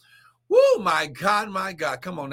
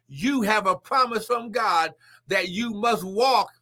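normal talking voice

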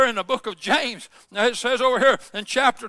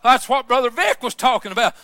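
normal talking voice

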